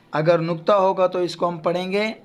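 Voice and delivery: usual speaking voice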